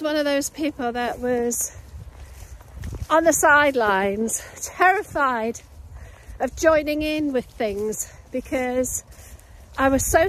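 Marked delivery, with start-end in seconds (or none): none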